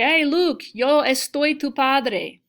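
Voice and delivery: hammed-up Vader saying it the wrong way; mock Darth Vader, wrong verb. Shown as a demonstration. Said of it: "I'm not talking about a feeling".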